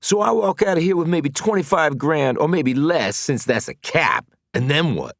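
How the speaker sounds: bitter outrage. Acted. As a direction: spit it out fast and loud. Mars angry.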